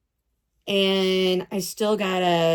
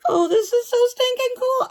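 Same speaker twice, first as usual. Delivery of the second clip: much higher, louder gleeful squeal; excited squeal; 3 dB louder than usual. Real, not acted. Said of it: "repeated higher".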